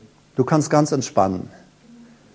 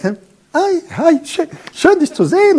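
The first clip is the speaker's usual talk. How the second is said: mock sweet greeting, much higher; put-on sweet high-pitched greeting; sugary high voice; 14 semitones higher, slower than usual. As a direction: imitate a falsetto too-friendly hello. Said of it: "die Stimmen sind so ganz, ganz nett".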